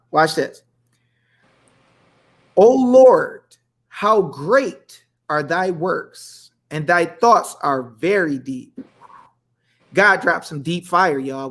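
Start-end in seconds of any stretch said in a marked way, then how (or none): 2.6-8.8 slow, drawn-out Bible reading; solemn scripture reading. Acted. Livestream host reading psalm to viewers, slow and solemn. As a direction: read the verse like a preacher, stretching words. Reads Bible verse slowly.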